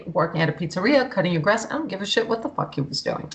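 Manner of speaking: ordinary talk, nothing marked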